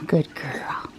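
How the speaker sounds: soft whispered praise to the cat. Real, not acted. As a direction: breathe it to her gently.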